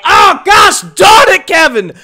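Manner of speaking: frustrated shout at Kevin's voicemail; frustrated yell. Real, not acted. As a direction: yell that you're not done.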